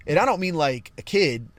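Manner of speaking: ordinary talk, nothing marked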